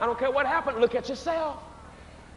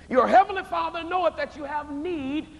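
heavenly lifted and leaned on; stress on heavenly; 5 semitones higher than usual. Shown as a demonstration. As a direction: lean hard into heavenly. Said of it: "underline this next word".

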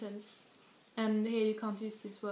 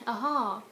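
"Aha" stressed on its second syllable, louder; last-syllable stress; 5 dB louder than usual. Shown as a demonstration. Teacher showing I-see reply, "Aha" stressed on the end. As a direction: push the second half of "Aha". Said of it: "stress the last syllable instead".

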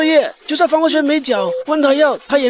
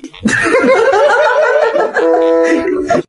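high loud laugh; 13 semitones higher, 5 dB louder than usual. Real, not acted. Laugh at pun.

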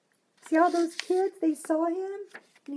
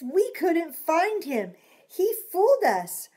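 put-on excited kids; 6 semitones higher, 6 dB louder than usual. Kids admit defeat excitedly.

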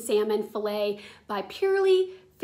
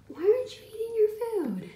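talking to a pet; 10 semitones higher, faster than usual. Real, not acted. Talking to moody cat.

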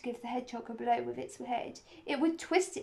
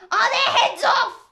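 high, loud, drawn-out demand; shouted question; 12 semitones higher, 13 dB louder than usual. A narrator's reading. Queen shouts to the soldiers for their report. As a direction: shout it imperiously, stretched out. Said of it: "shouted the Queen".

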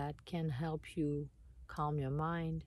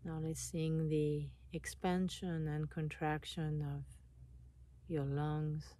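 calm meditation voice with long pauses; guided meditation; 5 dB quieter than usual. Acted. Guiding listeners to relax and notice their breath, meditation voice. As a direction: guide them inward, unhurried, leaving space between phrases.

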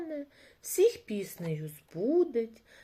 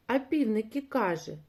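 ordinary delivery

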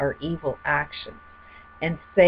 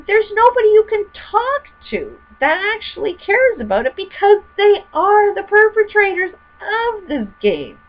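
heated rant; 6 dB louder than usual. Real, not acted. Heated rant about corporations and government.

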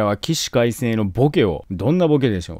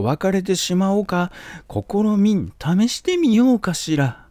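higher, slower, wistful lady's voice; musing mother; 7 semitones higher than usual. Retold or imitated. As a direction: muse aloud like a weary noblewoman.

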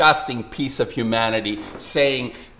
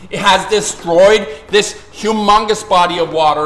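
higher and louder, shouting in outrage; furious rant; 4 semitones higher, 6 dB louder than usual. Real, not acted. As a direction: let the anger boil over at the listeners. Furious rant about Pacific destroyed.

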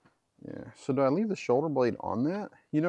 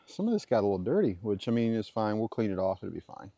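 ordinary talk

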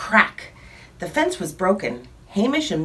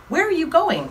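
high-pitched calling pig voice; a much higher piggy voice for the question; curious pig; 10 semitones higher than usual. Acted. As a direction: call out like a nosy little pig. Pig calls out to cows.

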